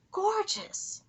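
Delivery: Gorgeous! rising high in delight; high excited Gorgeous!. Real, not acted. Reacting to night sky.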